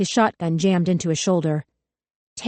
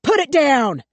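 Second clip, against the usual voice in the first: loud, higher commanding scream; 8 semitones higher, 5 dB louder, slower than usual. A narrator's reading. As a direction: scream it over the Glock.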